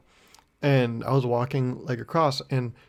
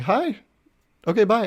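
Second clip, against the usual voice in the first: much higher, quick chirpy brush-off; breezy woman; 8 semitones higher, 4 dB louder than usual. Retold or imitated. The driver brushing him off, chirpy and high. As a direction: toss it off and leave fast. Retelling her goodbye.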